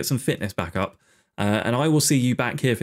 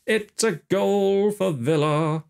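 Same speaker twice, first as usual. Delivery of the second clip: mock commentator call; 7 semitones higher, much slower than usual. Drawn-out repeat of goal call.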